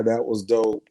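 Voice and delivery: ordinary delivery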